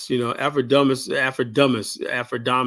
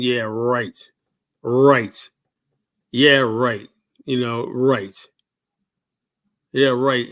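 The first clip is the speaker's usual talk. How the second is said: louder, scoffing yeah right; sarcastic scoff; 3 dB louder, much slower than usual. Real, not acted. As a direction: throw it back in disbelief. Scoffing at his success stories.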